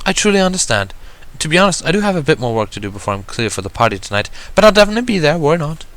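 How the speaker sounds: a poised unicorn gently reminding her sister; Rarity's voice. Acted.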